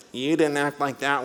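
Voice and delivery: put-on nasal, whining older brother; whiny nasal brother. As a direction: whine it through the nose like a sulky teen. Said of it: "in a nasally voice".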